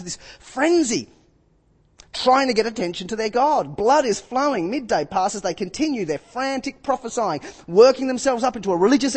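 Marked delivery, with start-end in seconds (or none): none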